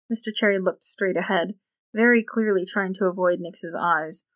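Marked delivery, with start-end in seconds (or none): none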